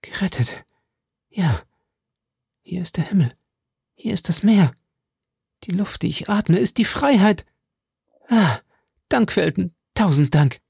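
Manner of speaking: high, breathless rapture rising; ecstatic, gasping thanks; elated relief. Acted. Milady jubelt über Freiheit. (Milady befreit, atemlos jubelnd und hoch)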